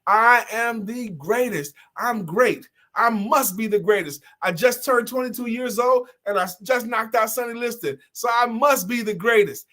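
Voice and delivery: a hint of a young champion bragging; boxer's boast. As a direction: brag like a fresh champion. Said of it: "he's doing like this".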